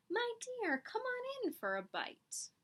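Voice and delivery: high, slow sugary fox; sly fox voice. Acted. Fox luring the chick into her cottage, sly sweet voice. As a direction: coax her in like a wily fox.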